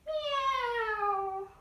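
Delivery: high cat meow; playful high meow for cat pose. Pretend. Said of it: "you might wanna do a meow".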